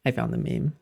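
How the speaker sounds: ordinary talk, nothing marked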